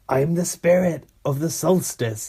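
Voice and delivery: badly imitated ghost character; mock ghostly spirit. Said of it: "That's not his accent. What's his accent?".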